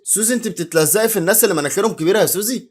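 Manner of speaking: ordinary delivery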